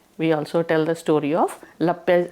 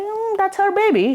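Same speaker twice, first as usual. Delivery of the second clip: gentle explaining husband; 12 semitones higher than usual. Acted. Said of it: "he's like".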